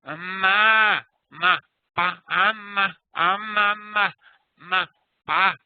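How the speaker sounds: put-on a touch of baby "ah, ma, pa" babble, pitched a little high; baby babbling. Teacher lightly imitating a babbling baby's "ma, pa". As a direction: babble the syllables like an infant. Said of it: "they'll go with are the labials".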